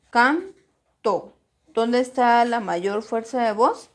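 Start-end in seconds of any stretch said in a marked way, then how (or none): 0.1-1.4 "Canto" slowly split into can, to; syllable by syllable. Shown as a demonstration. Teacher repeats the separated word, "Canto" syllable by syllable. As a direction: clear pause between CAN and TO in "Canto".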